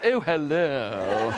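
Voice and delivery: slow, drawn-out tiger hello; tiger's greeting. Acted. Tiger greets the Brahmin, slow put-on voice. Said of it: "it looked and it said".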